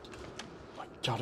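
slow, breathy whisper; scared whisper. Real, not acted. Gamer too scared to speak, whispering slowly. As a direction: barely whisper it out of fear. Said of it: "I can't even speak when I'm scared".

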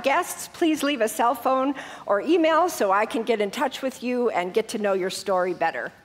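normal talking voice

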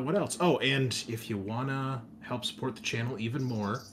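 ordinary talk, nothing marked

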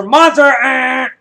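high, loud cry of triumph; real winning-answer whoop; excited shout. Real, not acted. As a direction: yell the winning word. Host cheers the winning match.

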